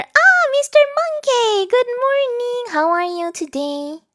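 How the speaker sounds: high, loud, drawn-out sing-song hello; playful exaggerated greeting. Streamer greeting viewer Mister Monkey, playful exaggerated greeting.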